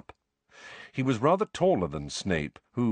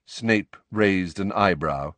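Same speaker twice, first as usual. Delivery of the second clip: usual speaking voice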